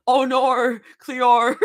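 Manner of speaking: hammed-up Australian, loud and drawn out; slow, high Australian accent through laughter; exaggerated Aussie vowels, laughing; bad Australian accent. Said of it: "read in a best Australian accent".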